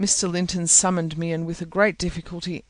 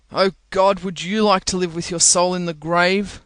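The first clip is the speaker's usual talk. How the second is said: usual speaking voice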